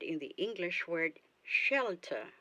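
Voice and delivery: normal talking voice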